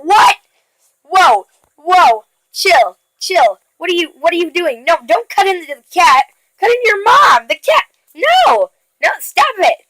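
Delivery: hammed-up outrage at the story; shocked protest. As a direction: beg the character to stop, alarmed. Reader protests the cat being cut.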